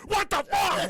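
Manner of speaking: high, loud yelled what the fuck; mock shocked yell. Passengers reading the cancel email, shrieking in shock.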